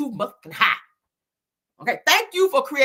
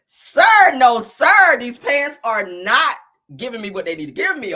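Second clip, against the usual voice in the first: loud mock scolding; 2 semitones higher, 9 dB louder than usual. Host objecting to [name]'s pants, loud mock scolding. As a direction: shut him down loudly, dragging out the verdict.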